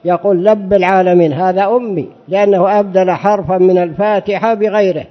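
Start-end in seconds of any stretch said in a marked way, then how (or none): 0.4-1.3 لب with a lam instead of ra; lisping ra as lam. Shown as a demonstration. Teacher repeating the lisper's reading for students. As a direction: say لب العالمين like a lisper. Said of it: "معه لتغة في لسانه".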